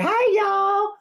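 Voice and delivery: high, loud mock-Southern greeting; Southern aunt impression. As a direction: squeal it like a newly Southern aunt. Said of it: "Two weeks, she was like".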